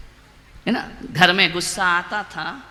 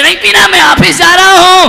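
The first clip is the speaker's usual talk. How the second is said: high, loud sulky refusal; huffy refusal; 9 semitones higher, 12 dB louder than usual. Acted. Husband refusing water, shouting angrily. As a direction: storm off in a huff. बोले.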